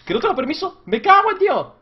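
high, loud outraged shout; outraged yell. Real, not acted. Gamer raging at the game's sleep message. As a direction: explode at the game. Game refuses to let him sleep.

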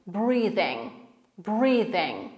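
"breathing" ending in a soft ng, no hard g; silent final G. Shown as a demonstration. Teacher shows the ending, "breathing" without pronouncing the final g. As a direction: stop "breathing" on the ng without releasing a g. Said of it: "nie ma w wymowie tego g".

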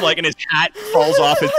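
ordinary talk

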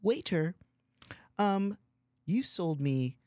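high, prim mock-polite voice; sweetly sarcastic complaint to the waiter; mock polite waiter call. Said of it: "I was like".

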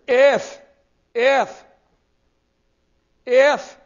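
"if" punched out three times, high and loud; stress on if. Real, not acted. Preacher telling listeners to notice this word, stressed "if". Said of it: "now notice this word".

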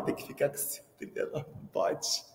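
sussurrando de brincadeira, sem voz; mock whisper. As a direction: cochicha como quem conta um segredo. Mock quiet aside. (sussurro de mentirinha para a mãe)

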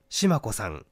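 ordinary delivery, nothing marked

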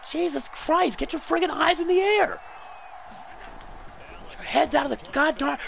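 very high, strained scolding; exasperated high yell. Real, not acted. Gamer scolds his own defenders in a very high yell. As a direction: scold them from the top of the voice.

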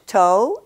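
"toe" with an oh-oo glide; relaxed gliding "toe"; English vowel set against the French; English diphthong. Shown as a demonstration. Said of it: "In English".

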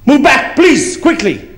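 mock Dutch driver telling the other car to move; bossy driver. Dutch driver demanding road space.

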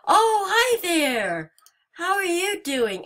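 high, loud, slow drawn-out hello; startled high greeting. Real, not acted. Startled player greeting the animatronic at the door. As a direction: greet the jump scare in a panic.

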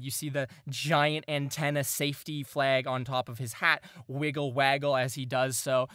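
normal talking voice

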